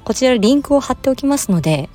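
normal talking voice

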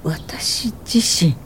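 slow, breathless whisper, caught off guard; stunned whisper. Acted. Repeating the interviewer's question to herself, a slow stunned whisper. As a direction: let the question fall out as a hushed whisper.

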